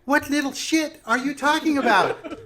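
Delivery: high witch voice, rising question; puzzled witch. Acted. Witch asks player about the one poking them, high and puzzled. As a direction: ask in a high, indignant witchy lilt.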